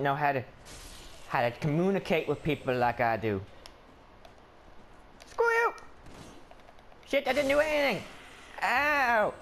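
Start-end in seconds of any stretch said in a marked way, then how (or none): 5.3-9.3 high-pitched indignant yelp; hurt protest. Pretend. Player hit though he did nothing, yelping protest.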